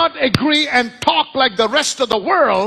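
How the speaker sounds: high-pitched exhortation to the congregation; forceful preaching. Acted. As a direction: urge the crowd like a preacher.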